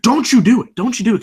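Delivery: sudden high, loud warning; mock hissy yell. Retelling Bobby Roode's hissy face, mock yelling. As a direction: snap out a petulant warning. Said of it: "He's like".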